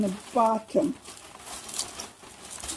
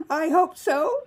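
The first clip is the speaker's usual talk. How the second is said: high, loud and eager; bright hopeful exclamation; 7 semitones higher, 5 dB louder, slower than usual. Acted. Hopeful, then whispered echo.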